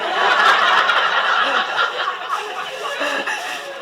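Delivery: big high burst of laughter; high laughing fit. Real, not acted. Chef cracking up at his own story, laughing hard. Laughs at retold butcher-shop remark.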